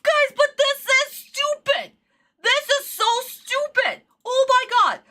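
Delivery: high, whining outrage; exasperated anger. Real, not acted. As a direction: complain in high-pitched disbelief. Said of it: "I am pissed".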